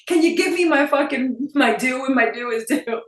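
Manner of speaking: loud, high-pitched comic rant; indignant outburst. Acted. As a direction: fire back in hammed-up outrage. Indignant retort to the imagined claim.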